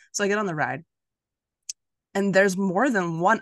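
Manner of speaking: usual speaking voice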